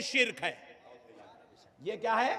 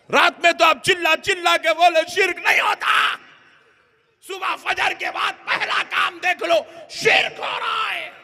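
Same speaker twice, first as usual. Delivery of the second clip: taunting shout; 6 semitones higher than usual. Retold or imitated. Opponent gloating at the cleric at the shrine, taunting shout. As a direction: rub it in with a shout.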